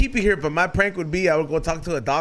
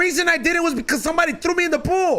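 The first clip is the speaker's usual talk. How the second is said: heated explanation; 10 semitones higher than usual. Real, not acted. Explaining the childhood pool trauma.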